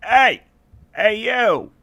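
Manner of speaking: high, loud guard's shout. Acted. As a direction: yell it across the beach. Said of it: "one of them shouts".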